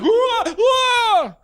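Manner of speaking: very high held "wah" shriek; hammed-up scream at being jumped; mock startled scream. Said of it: "he'd jump out and scare me".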